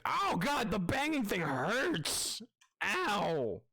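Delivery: high, drawn-out cry of a wounded monster; hurt creature. Acted. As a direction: wail slowly as the creature in pain.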